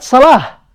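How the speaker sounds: "Salah" with a final h in place of t; ends with ha. Shown as a demonstration. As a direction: demonstrate "Salah" stopping on an audible h. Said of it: "It ends with ha".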